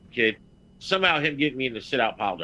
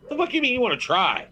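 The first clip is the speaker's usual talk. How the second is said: incredulous scoff; 11 semitones higher than usual. Real, not acted. Mocking a wrestler's "try.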